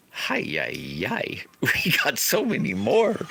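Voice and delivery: rising mock dismay with a laugh; laughing groan. Farmer facing a full field, laughing dismay. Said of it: "look at the field and say".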